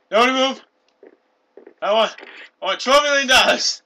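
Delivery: loud, high mock demands; hostage taker. Plays hostage taker making demands.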